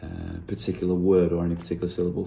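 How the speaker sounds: normal talking voice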